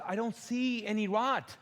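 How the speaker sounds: high, slow protest; mock protest. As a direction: drag it out high. Arguing with wife.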